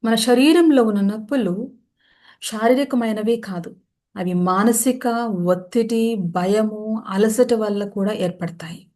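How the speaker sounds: measured meditation voice with long pauses; guided meditation. Acted. Yoga teacher settling listeners into shavasana, guided meditation voice. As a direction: guide them into rest, phrase by phrase.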